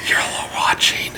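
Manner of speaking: playful hushed whisper; mock whisper. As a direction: whisper it as a teasing aside. Teasing echo of the watching quote.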